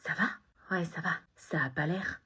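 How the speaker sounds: hushed whisper. Acted. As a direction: whisper it so nobody hears. Secret talk between friends.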